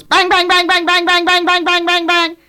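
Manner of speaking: high, loud rapid-fire bangs; frantic volley on one high pitch; shotgun blasts. Acted. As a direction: fire off each word like a panicked shot. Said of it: "he grabs up his shotgun and he goes".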